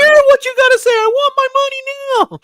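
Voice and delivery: shouted squeaky falsetto, fast and demanding; Mickey Mouse. Acted. Cartoon boss demanding money from a comic nerd, angry squeaky impression. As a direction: yell it as a furious high-pitched cartoon mouse. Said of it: "doing my best Mickey Mouse impression".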